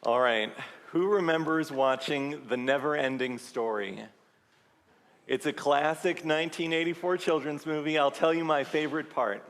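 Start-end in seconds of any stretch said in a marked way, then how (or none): none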